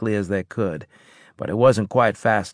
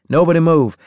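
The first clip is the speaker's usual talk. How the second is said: loud, high urgent command; shouted order; 9 semitones higher, 9 dB louder, faster than usual. Acted. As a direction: shout it so nobody moves.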